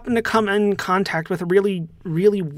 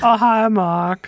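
movie line quote; 4 semitones higher, 4 dB louder, slower than usual. Acted. Quoting a famous movie line.

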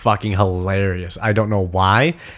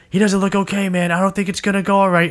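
nervous teammate, higher; 10 semitones higher than usual. Retold or imitated. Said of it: "He's like".

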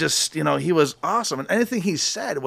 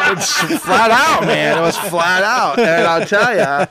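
laughing stoner drawl; 2 semitones higher, 5 dB louder, slower than usual. Retold or imitated.

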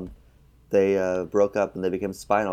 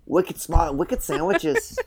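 put-on higher, slow Boston accent, laughing through it; mock Boston accent; 8 semitones higher, much slower than usual. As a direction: stretch out wicked sandwiches in a hammed-up Boston drawl. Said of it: "are you from Boston?".

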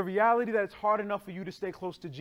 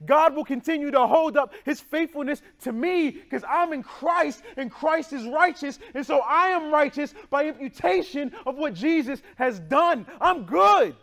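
rising louder and higher to a peak; building preaching; 5 semitones higher, 4 dB louder than usual. Real, not acted. Preacher to congregation, building to a triumphant high cry.

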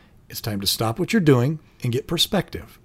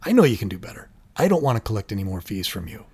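softer, caring encouragement; genuine concern; 5 semitones lower, 5 dB quieter than usual. Pretend. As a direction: gently tell them you believe in them. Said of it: "you can express genuine concern".

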